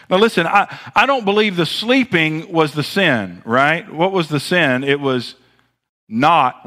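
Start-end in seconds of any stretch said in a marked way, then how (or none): none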